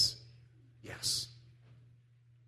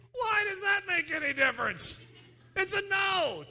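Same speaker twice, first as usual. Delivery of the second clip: much higher, shouted disbelief; exasperated protest; 13 semitones higher than usual. Retold or imitated. Listener frustrated with the preacher's no ticket, high exasperated shout.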